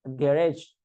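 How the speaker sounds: a touch of English Garage; English pronunciation. Shown as a demonstration. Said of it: "ya, ji".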